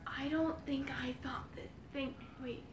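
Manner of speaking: very soft, voiceless whisper; barely audible whisper. Real, not acted. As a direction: whisper it under your breath. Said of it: "you have to whisper".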